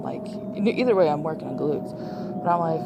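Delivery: ordinary talk, nothing marked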